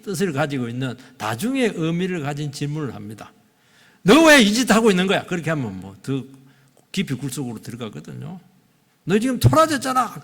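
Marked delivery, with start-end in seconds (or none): 4.0-5.2 loud, high accusing demand. Acted. Preacher acts out a harsh question to someone hiding, accusing. 9.0-10.2 accusing. Acted. Acting the accusation God avoids.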